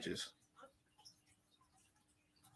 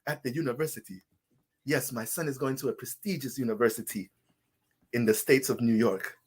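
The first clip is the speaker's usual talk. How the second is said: softer mock Nigerian parent accent; a light imitation of a Nigerian accent; 3 semitones lower, 6 dB quieter than usual. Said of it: "That's how African people talk".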